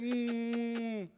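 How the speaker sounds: usual speaking voice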